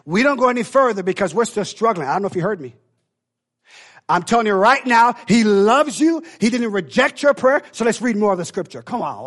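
high, loud preaching climb; full-force preaching. Acted. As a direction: drive it home to the crowd.

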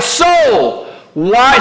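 high, forceful summons to your own soul; emphatic self-address. Shown as a demonstration. Preacher showing the congregation how to speak to the soul, emphatic. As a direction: call SOUL out high, as a command. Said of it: "I want you to speak to your soul".

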